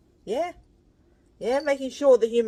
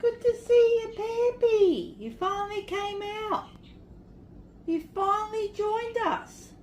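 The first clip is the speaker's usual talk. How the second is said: pet talk; 6 semitones higher than usual. Real, not acted. Greeting shy cat Peppy.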